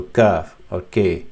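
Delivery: kaf with a simple light English k; easy, unstressed k. Shown as a demonstration. Teacher contrasting it with qaf, easy light kaf. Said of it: "It's very easy sound".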